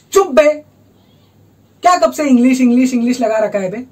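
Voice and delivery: sudden irritated bark; angry outburst. Acted. Interrupted for demanding English.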